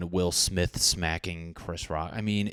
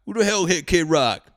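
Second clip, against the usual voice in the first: higher, louder mock old guy bellowing; old man yelling, much higher; old man shouting; 10 semitones higher, 7 dB louder than usual. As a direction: holler back up from the basement. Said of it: "some guy's in the basement".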